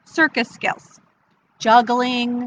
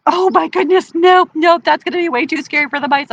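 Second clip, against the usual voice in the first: high, loud flustered rejection; alarmed refusal; 6 semitones higher, 5 dB louder, faster than usual. Acted. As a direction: recoil and refuse in a hurry. Woman alarmed by snake's voice.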